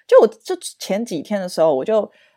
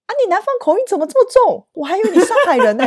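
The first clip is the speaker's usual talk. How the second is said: mock interviewer; 12 semitones higher than usual. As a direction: ham up the interviewer's disbelief. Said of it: "他就说".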